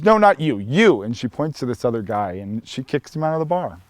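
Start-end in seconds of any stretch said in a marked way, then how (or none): 0.0-1.0 high, loud sharp pointing out; emphatic correction. Retold or imitated. Bartender Patty redirects her order to the drunk, loud and high. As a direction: snap it while pointing at the other guy.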